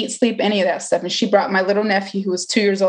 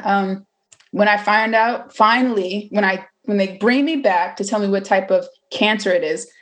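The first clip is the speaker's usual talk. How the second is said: ordinary talk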